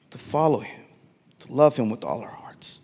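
hushed whisper of devotion. Real, not acted. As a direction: breathe the words softly. Drops to whisper in sermon.

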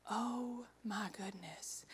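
slow, hushed disbelief; stunned whisper. Acted. Young woman learning she is pregnant, stunned whisper. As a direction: whisper it slowly, as the news sinks in.

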